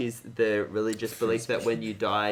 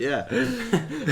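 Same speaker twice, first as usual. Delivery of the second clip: laughing; 11 semitones higher than usual. Real, not acted. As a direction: crack up while joking about the box. Joking about diplomatic materials.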